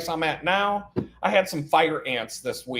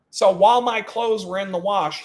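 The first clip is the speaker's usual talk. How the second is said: ordinary talk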